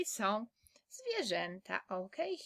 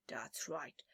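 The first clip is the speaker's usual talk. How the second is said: whispering; 11 semitones lower, 15 dB quieter than usual. Real, not acted. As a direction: whisper it gently. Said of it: "teacher whispers to child".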